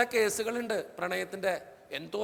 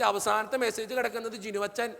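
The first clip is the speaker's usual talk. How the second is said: ordinary talk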